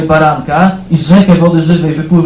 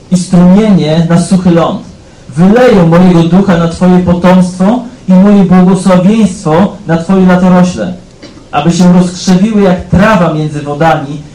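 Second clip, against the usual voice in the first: louder, proclaiming Bible reading; solemn scripture reading; 3 semitones higher, 7 dB louder than usual. Acted. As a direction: declare the prophet's words to the hall. Reads Isaiah aloud forcefully.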